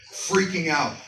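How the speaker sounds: ordinary delivery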